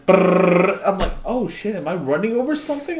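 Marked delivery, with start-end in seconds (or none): 0.1-0.8 rolled lips tire rumble noise; mock vibration noise; imitated rumble. 1.2-3.0 much higher, quick mock panic; retold driver panic, high and fast; alarmed.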